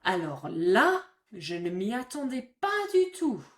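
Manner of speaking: big loud astonished gasp of a phrase; surprised. Shown as a demonstration. Teacher acting out shock for French learners, astonished. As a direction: caught completely off guard. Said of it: "the tone ... does a lot of work".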